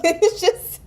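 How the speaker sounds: high laugh breaking the words; cracking up mid-sentence. Real, not acted. Laughing fit.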